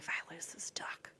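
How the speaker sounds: whispered worry. Retold or imitated. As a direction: breathe it out under your breath. Whispered worried inner thought.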